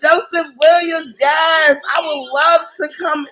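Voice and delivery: excited shout. Real, not acted. Hyping up the host.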